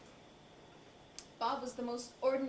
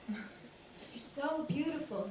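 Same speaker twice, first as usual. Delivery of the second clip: slow, breathy awe; 4 semitones higher, much slower than usual. Acted. Bob gazing at her like a hungry beast, slow and breathy. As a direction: draw it out on the breath, entranced. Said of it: "He got breathy".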